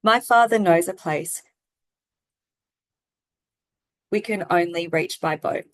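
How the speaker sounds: mildly monotone mock student read-aloud; no expression. Shown as a demonstration. Student reading a picture book to the class, with no expression. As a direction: read word by word without feeling. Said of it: "they were reading with no expression".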